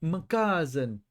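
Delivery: very slow, drawn-out mock Italian; cartoonish Italian. After mentioning Jared Leto, slow cartoonish Italian accent. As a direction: stretch it like a cartoon mobster. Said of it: "the most cartoonish Italian ever made".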